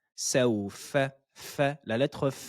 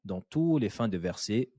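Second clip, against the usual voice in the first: ordinary talk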